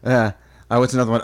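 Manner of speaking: ordinary talk, nothing marked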